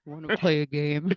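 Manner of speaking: low, slow, creepy whisper-ish game master; creepy horror villain. Retold or imitated. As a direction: menace them slowly. Saw villain quote again.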